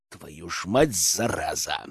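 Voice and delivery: low, soft curse; cursing under the breath. Acted. Leader hears of the accident, cursing under his breath. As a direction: swear to yourself, voice dropped.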